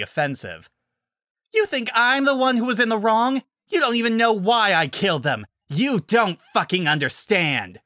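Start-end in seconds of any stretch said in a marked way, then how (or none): 1.5-7.8 furious shouting. Acted. Villain raging at hero.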